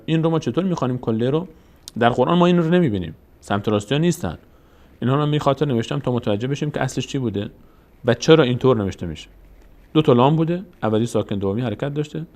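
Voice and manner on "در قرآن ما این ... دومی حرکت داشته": ordinary talk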